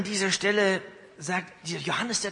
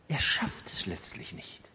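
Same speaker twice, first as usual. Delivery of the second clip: whispered, low and soft; sudden unvoiced hush in a sermon; 8 semitones lower, 7 dB quieter than usual. Real, not acted. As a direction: let the verdict sink in a whisper. Preacher drops to hushed verdict.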